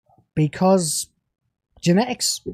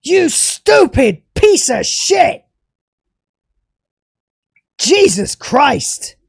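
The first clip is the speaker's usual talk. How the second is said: loud, furious tirade, spitting every insult; real fury at the showrunner; raging at the writers of the show; angry rant; 4 dB louder, much slower than usual. Real, not acted. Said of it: "This angry rant".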